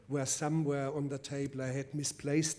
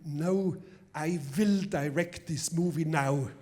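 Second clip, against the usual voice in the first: hammed-up thick German accent, louder; worst German accent; 2 semitones higher, 6 dB louder than usual. To the producer, seizing the film: hammed-up loud German accent. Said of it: "I mustered my worst German accent".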